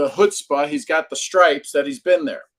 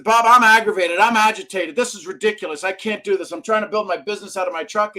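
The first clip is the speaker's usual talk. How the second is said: louder, higher, venting to Bob; aggravated rant; 6 semitones higher, 5 dB louder than usual. Retold or imitated. Retelling his call to mentor Bob, mock aggravated. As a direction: pour out the frustration on the phone. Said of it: "I called Bob and I said".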